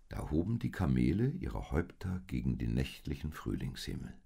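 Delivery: ordinary delivery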